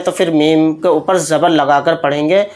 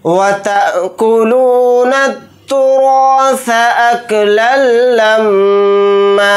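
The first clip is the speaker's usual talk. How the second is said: slow, higher chanted recitation; Quran recitation; 4 semitones higher, much slower than usual. Acted. Teacher reciting the next ayah aloud. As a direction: recite the ayah slowly, with lilt.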